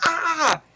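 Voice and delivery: high-pitched "ah" of pain; acted high yelp; mock pain scream. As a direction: imitate a cry at a broken finger. Imitating pain from broken finger.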